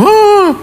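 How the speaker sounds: high-pitched imitation of scared children; mock frightened gasp. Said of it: "anak-anak ketakutan semua".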